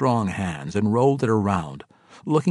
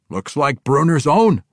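dwarf voice; 7 semitones higher, 7 dB louder than usual. Acted. King of Felbar replies.

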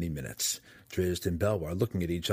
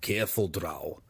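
gnome's hushed warning, only slightly lowered; whispered; 2 semitones lower than usual. Acted. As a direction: warn a companion in a guarded undertone. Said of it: "Belwar whispered".

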